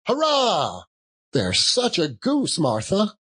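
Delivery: put-on high, cheering child's voice; excited children. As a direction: cheer it out like thrilled little kids. Children cheering about the goose.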